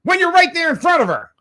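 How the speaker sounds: high, loud indignant rant. Real, not acted. Host ranting about the Lisbon McDonald's, high and loud. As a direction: build into an outraged rant.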